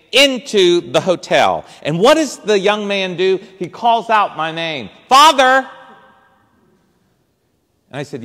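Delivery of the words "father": loud, high call across the street; hailing the priest from a distance. Retold or imitated. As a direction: call out to catch his attention. Homeless boy calling out to priest.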